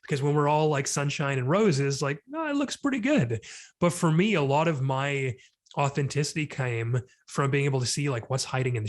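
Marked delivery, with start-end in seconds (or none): 2.3-3.4 bright, high falsetto brush-off, far higher than usual; breezily pretending everything's fine; mock cheery denial. Mocking the sunshine-and-roses crowd, high breezy fake cheer.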